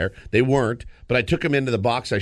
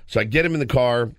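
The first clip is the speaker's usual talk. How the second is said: usual speaking voice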